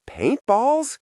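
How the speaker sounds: high incredulous shout; shouting in disbelief. A narrator's reading. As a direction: yell it in disbelief. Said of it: "she shouts".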